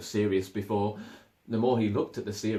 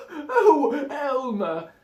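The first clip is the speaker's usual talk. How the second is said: loud, high, laughing and breathless; gasping laugh; 8 dB louder, much slower than usual. Acted. An old elephant gasps Elmer's name, laughing hard. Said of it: "gasped an old elephant".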